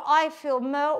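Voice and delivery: ordinary delivery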